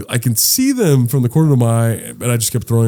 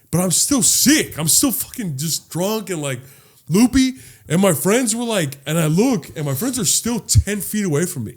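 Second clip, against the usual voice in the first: ordinary delivery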